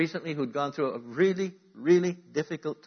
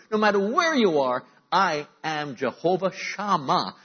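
raised, ringing preacher's voice; fervent preaching; 4 semitones higher, 4 dB louder than usual. Real, not acted. Preacher proclaiming God's presence to his church. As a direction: preach it out full force.

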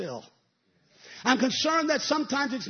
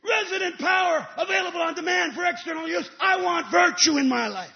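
loud preacher's cry, voice raised; preaching at full force; 7 semitones higher, 8 dB louder than usual. Acted. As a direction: shout your longing out to the church. Preacher shouting at climax.

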